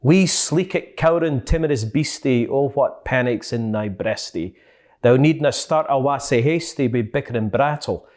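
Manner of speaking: a proud Scottish poetry reading; Scots poem recital. Shown as a demonstration. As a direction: declaim Burns in broad Scots. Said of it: "So here it goes".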